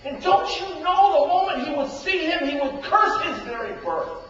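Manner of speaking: high, loud cry of outrage; preacher acting a brother's outrage; mock outrage. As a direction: shout his fury to the rafters. Preacher voicing brother's fury.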